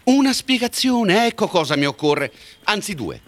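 high, heated, demanding; angry demand. Acted. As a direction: press hard for an answer.

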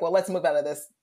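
loud, fast sarcastic rant; retold outburst. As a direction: rant in mock outrage, climbing to "shut up". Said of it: "I lost my mind".

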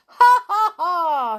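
high, loud, taunting ha ha ha; mocking laugh. A narrator's reading. Gnome laughs at the toys from his aeroplane. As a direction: laugh down at them from above. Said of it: "he said".

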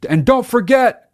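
high, loud villain call after her; a hammed-up parting shout; yelling out. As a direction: call across the distance with mock menace. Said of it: "he yells out to her".

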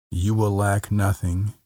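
usual speaking voice

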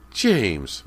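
high, loud reproachful call; scolding cry. A narrator's reading. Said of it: "Missus Fraser cried".

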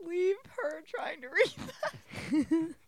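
squealing through laughter; laugh-crying protest. Real, not acted.

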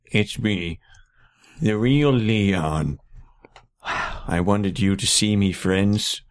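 lightly voiced very old man. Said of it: "very old man".

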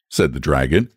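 usual speaking voice

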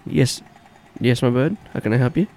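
phone voice. Pretend. [name] answering phone.

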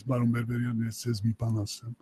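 low, quiet, grave imitation; hushed and serious, voicing the other man. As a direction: play a solemn man coming over to talk. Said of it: "this guy comes very serious".

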